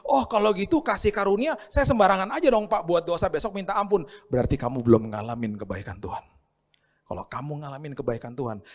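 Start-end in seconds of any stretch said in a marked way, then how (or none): 0.1-4.1 higher and louder, cheeky know-it-all; mock the one abusing grace; mocking cheeky believer. Careless churchgoer bargaining to sin freely, high and cheeky. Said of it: "Orang yang bilang begini".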